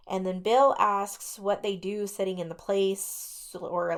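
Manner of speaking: ordinary talk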